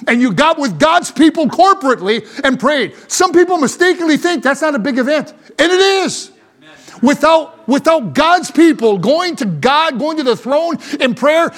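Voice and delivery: impassioned pulpit delivery; forceful preaching. Real, not acted. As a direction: drive each phrase home to the congregation.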